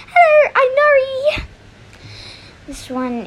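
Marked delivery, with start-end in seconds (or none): 0.1-1.5 very high, loud squeaky voice; Nari's voice. Shown as a demonstration. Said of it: "Nari's voice".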